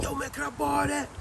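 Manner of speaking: high pleading voice; family member begging. Retold or imitated. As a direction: wheedle for the CD. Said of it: "He said".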